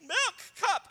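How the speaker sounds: dad imitating his son at the fridge; toddler demand. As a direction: whine like a toddler wanting milk. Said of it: "but he goes".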